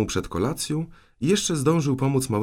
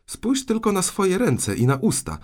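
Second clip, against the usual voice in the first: raised, high-pitched telling-off; indignant aunt catching out a culprit; scolding; 10 semitones higher, 4 dB louder than usual. Acted. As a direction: confront the guilty child. Aunt scolds the guilty boy.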